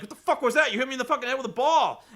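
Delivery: loud, high, fast outraged yell; angry yelling. Retold or imitated. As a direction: yell in fury at whoever hit you. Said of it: "yell at each other".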